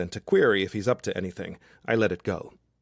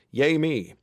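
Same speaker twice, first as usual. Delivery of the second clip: self-cheer; 6 semitones higher, 4 dB louder than usual. A narrator's reading. Nervous self-cheer mid-proposal.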